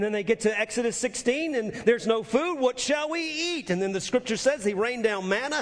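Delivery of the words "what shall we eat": whining complaint. Retold or imitated.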